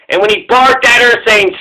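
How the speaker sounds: ordinary talk, nothing marked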